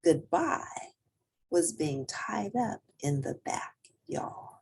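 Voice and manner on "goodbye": plain reading voice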